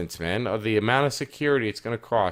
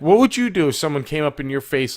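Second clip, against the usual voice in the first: ordinary talk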